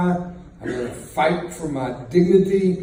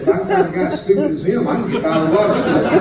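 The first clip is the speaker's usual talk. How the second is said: laughing punchline; 5 semitones higher, 8 dB louder, faster than usual. Retold or imitated. Retold inner thought, laughing.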